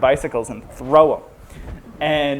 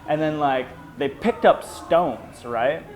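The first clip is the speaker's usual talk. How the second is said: ordinary talk, nothing marked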